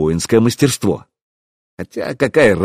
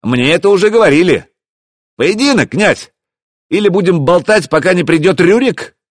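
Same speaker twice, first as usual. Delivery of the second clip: high, raised call; shouted challenge; 8 semitones higher, 4 dB louder than usual. A narrator's reading. Hero pressing the prince to duel, shouting. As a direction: goad the enemy from afar.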